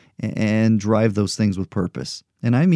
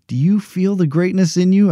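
rousing question; 9 semitones higher, 4 dB louder than usual. Real, not acted. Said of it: "rhetorical question to listener".

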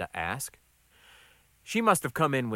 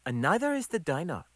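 higher woman character; gentle admonishment; 6 semitones higher, faster than usual. A narrator's reading. Gonda admonishes Max about diner, higher voice. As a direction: remind him in a raised-pitch voice. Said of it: "admonished Gonda at his side".

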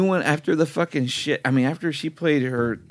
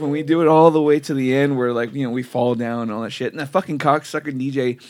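normal talking voice

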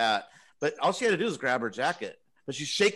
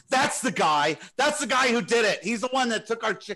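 higher, insistent mock complaint; retold accusation; 10 semitones higher than usual. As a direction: point out the culprit to your husband. Retold wife accusing him to husband.

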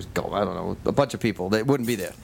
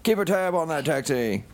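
movie chase shout; 7 semitones higher, slower than usual. Pretend. Mock movie chase command.

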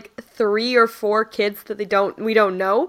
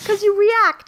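smug husband; 10 semitones higher than usual. Retold or imitated. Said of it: "he's like".

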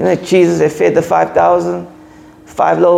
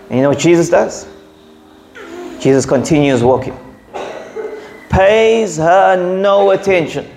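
ordinary talk

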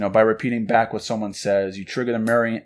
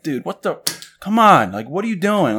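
exasperated complaint; 8 semitones higher, 3 dB louder than usual. Retold or imitated. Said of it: "expecting to be like".

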